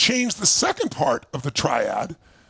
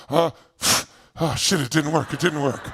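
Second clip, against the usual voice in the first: low, sighing let-down mutter; deflated half-hearted attempt; 6 semitones lower than usual. A fire walker giving up after a weak try, low and deflated. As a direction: sound like the try fizzled out. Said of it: "They'll go".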